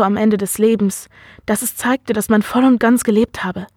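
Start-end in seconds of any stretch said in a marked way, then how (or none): none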